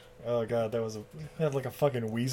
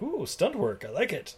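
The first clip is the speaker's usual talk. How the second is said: soft, savoring ooh with a swooping pitch; hushed mock admiration; appreciative aside; 6 semitones higher, 7 dB quieter than usual. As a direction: say it softly, pleased with the spectacle. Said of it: "I'm like".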